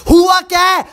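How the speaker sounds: sharp, loud demand; exasperated shout. Acted. Fed-up mother-in-law shouts at the crying bride. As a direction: snap the question out in frustration.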